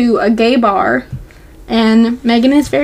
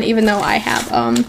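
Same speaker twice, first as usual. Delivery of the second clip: usual speaking voice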